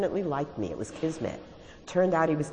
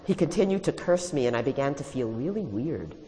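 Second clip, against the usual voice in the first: plain reading voice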